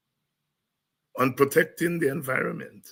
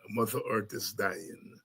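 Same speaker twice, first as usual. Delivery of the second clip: "Mother Earth is dying" dropped to a soft murmur; soft echo; 5 semitones lower, 10 dB quieter than usual. Real, not acted. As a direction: let "Mother Earth is dying" fall away quietly. Echoing the title softly.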